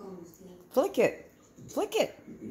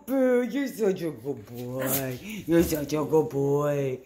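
warm praise; 8 semitones lower than usual. Real, not acted. Praising the dog.

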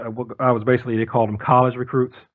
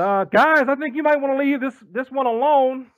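high warning voice; 12 semitones higher than usual. Retold or imitated. Warning the arguing guys.

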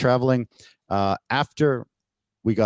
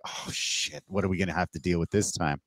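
muttered thought; 7 dB quieter than usual. Retold or imitated. Inner dread while travelling.

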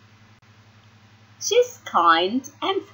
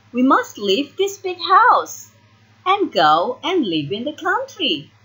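plain reading voice

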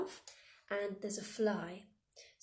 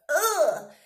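much louder, higher disgusted ugh; 7 semitones higher, 12 dB louder than usual. Shown as a demonstration. Teacher demos the disgusted fly-eating noise. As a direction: retch out a horrible ugh. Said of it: "make a disgusted noise".

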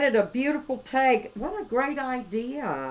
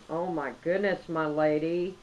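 ordinary delivery

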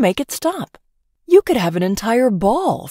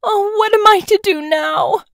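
much higher, wailing lament; mock sob; 14 semitones higher, 4 dB louder than usual. Banished princess lamenting, wailing sob. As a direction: wail it like a ruined princess. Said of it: "she sobbed".

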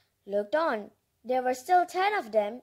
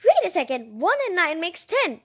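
plain reading voice

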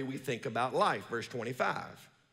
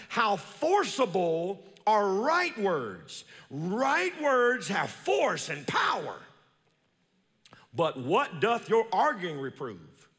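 slow, solemn reading of the verse; slow, reverent scripture read; 4 semitones higher, 3 dB louder, much slower than usual. Acted. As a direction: lift the old words slowly. Reads Proverbs verse aloud.

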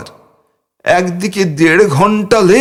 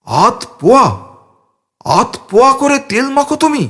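astonished; 7 semitones higher than usual. A narrator's reading. Shocked repeat of amount.

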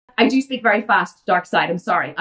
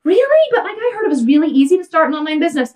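high, fast, naive disbelief; mock a clueless beginner who thinks it's easy; naive mock whine; 5 semitones higher, faster than usual. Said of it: "And they're like".

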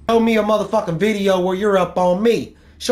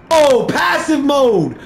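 slow, higher and louder gloating; excited taunt; 6 semitones higher, 5 dB louder, much slower than usual. Real, not acted. Gamer crowing at rival gone passive, slow gloat. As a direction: drag it out, crowing.

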